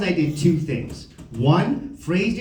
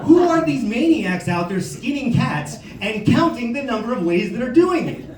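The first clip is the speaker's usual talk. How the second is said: higher, incredulous demand; mock outrage; 6 semitones higher than usual. Comic questioning who skins cats, incredulous. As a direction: exclaim in baffled disbelief.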